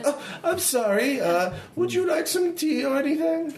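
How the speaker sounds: very high falsetto hostess; flustered mother in a high put-on voice; polite, apologetic mother. Mother offering visitors tea, high and apologetic.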